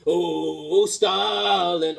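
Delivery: normal talking voice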